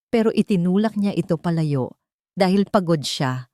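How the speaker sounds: plain reading voice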